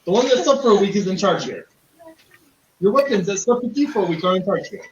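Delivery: chuckling through the line; laughing mid-sentence; amused half-laugh. Real, not acted.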